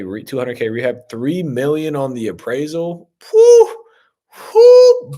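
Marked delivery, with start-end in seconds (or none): 3.3-5.1 high, loud whistling whew of amazement; two loud impressed whews; excited whew. Real, not acted. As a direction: blown away, let out a big whew. Reacting to a huge appraisal.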